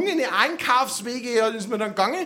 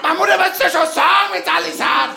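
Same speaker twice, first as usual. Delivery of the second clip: louder, higher exasperated outburst; exasperated shout; 5 semitones higher, 5 dB louder than usual. Retold or imitated. Boy silently yelling at his mother about thanking, loud and high. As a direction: blurt it out fed up.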